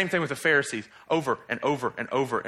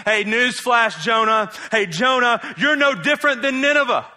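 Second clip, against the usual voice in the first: preacher's call-out; 6 semitones higher, 4 dB louder than usual. Acted. Preacher calling out Jonah.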